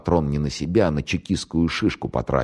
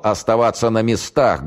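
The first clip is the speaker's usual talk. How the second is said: raised, commanding order; threatening officer's command, moderately raised; stern bark; 6 semitones higher than usual. A narrator's reading. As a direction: order the men through a megaphone. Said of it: "грозно рявкнул старший майор в рупор".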